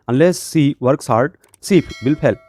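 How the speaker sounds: slow, careful reading with "unless" leaned on; slow dictation. Shown as a demonstration. Teacher dictates the corrected answer to her students. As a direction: read it out word by word for the class. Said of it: "यहाँ पे आपको लगाना है unless".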